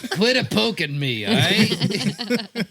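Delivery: slow, cranky drawl; grumpy old man. Pretend. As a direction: grumble at the poking kids. Voicing the cold escapee.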